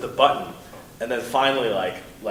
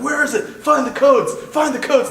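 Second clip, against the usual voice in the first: much higher, louder barked orders; mock raider shouting; 8 dB louder than usual. Armed raiders storming the house, demanding the codes, high mock shouting. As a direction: yell at your crew to search the place.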